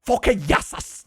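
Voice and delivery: high-pitched Afrikaans curse, half laughing; angry Afrikaans swearing. Shown as a demonstration. Demonstrating really angry Afrikaans swearing, mock angry. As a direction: spit out a playful curse. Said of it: "when you know I'm really angry".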